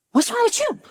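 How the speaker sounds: high-pitched retold demand; alarmed questioning. Wife questioning her stunned husband, alarmed. Acting out alarm at ex's face.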